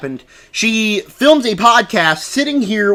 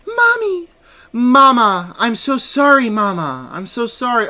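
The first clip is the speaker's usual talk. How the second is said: high, whiny-sweet apology in mockery; hammed-up bedside apology; mock pleading daughter; 6 semitones higher than usual. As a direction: send up a daughter begging her mother's forgiveness. Mocking daughter at comatose mother's bedside.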